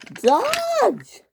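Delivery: very high drawn cry of Dodge; high wailed name. Acted. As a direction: wail the name. Flora crying out at attacker.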